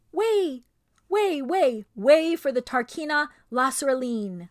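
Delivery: loud, high herald's call; crier's shout. A narrator's reading. Crier clearing the street, loud high shout. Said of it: "was heard shouting out".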